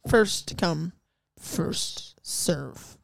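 very slow, each part set apart; slow spelling-out. Retold or imitated. Server to customer who doesn't understand, slow spelling-out. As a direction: spell it out for someone not getting it.

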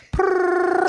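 high purring chirp; cat trill. Retold or imitated. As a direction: do a chirpy cat purr-row.

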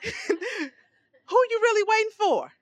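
slowed, laughing through the words; laughing preacher; laughing question. Real, not acted. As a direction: chuckle as you ask it.

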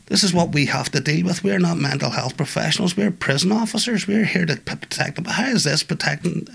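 exasperated officer. Retold or imitated. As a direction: let the officer's frustration push the pitch up. Frustrated officer quoted.

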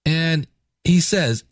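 normal talking voice

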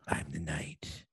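low, raspy Batman growl; imitated dark, raspy superhero voice. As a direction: drop deep and rasp it slowly. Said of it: "like, that low raspy voice".

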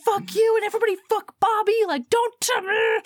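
high-pitched, fast acted rant; furious outburst. Heroine curses everyone pushing Bobby on her, furious.

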